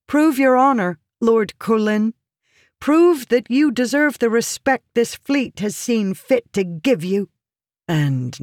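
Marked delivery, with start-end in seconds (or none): 0.1-7.3 high, forceful woman's challenge; challenging. A narrator's reading. Woman testing the lord's honor, challenging. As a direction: dare him to live up to it. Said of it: "she challenged".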